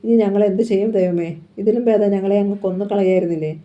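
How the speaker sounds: ordinary delivery, nothing marked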